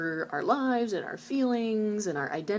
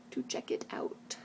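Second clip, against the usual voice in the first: whisper; 8 dB quieter than usual. Real, not acted. Playful aside urging viewers.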